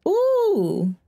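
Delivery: high, drawn-out ooh; a delighted gasp at the hit; long rising ooh; impressed ooh. Real, not acted. Reacting to a shove.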